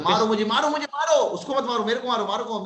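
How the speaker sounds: exasperated mock outcry; mock despair. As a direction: beg for punishment in mock anguish. Teacher begs to be beaten over mistake.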